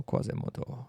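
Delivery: whispered name, breathed out. Pretend. Calling out to Quasimodo in a teasing whisper.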